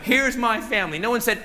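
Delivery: ordinary delivery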